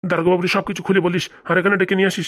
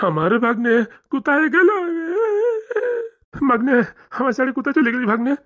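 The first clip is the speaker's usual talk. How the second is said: hammed-up wailing; 5 semitones higher than usual. Uncle mourns the missing nephew.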